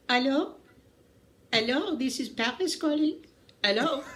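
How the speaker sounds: high-pitched French telephone operator; French accent. Retold or imitated. Paris operator calling New York, high French accent. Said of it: "that was a really good accent".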